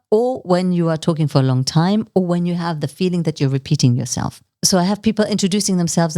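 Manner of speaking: ordinary delivery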